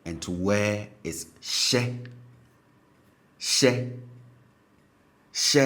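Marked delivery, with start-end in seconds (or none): 1.4-5.6 SHE said slowly on a high tone, like "sheh". Shown as a demonstration. Teacher models the verb to wear, SHE high-toned. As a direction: pitch SHE up, repeated with pauses. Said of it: "pronouncing this with a high tone".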